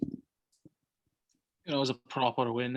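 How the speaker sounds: put-on a weak stab at a Liverpool accent; hammy, shaky Liverpool accent attempt. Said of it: "my attempt at a terrible Liverpool accent".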